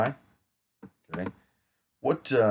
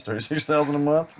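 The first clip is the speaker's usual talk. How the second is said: mock excitement; 7 semitones higher, 5 dB louder than usual. Real, not acted. Joking about a typo on income.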